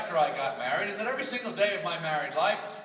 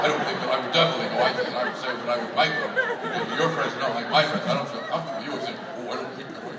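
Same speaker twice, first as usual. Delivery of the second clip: low-pitched mock man voice, rattled off; woman imitating man; 7 semitones lower, 5 dB louder than usual. Wife quoting her husband mid-argument, mocking low man voice. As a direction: mimic him in a dopey deep voice. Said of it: "imitate the voice of the man".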